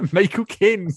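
mock Cockney impression, high and loud; hammed-up caricature of a London actor's voice; Michael Caine. Co-host joining in the accent joke, mock Cockney impression.

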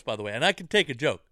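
normal talking voice

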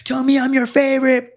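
very high, sustained put-on voice; high-pitched needy comic climax; needy mock plea. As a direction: plead it way up high. Acting out bit's needy climax.

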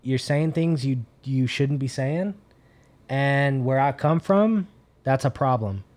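slow and deliberate warning. Retold or imitated. Stranger slowly warning Nick at the bar. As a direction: spell out the threat slowly.

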